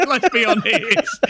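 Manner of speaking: high, drawn-out words breaking into laughter; laughing. Real, not acted. Storyteller joking to his co-host, real laughing delivery. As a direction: crack up at your own joke.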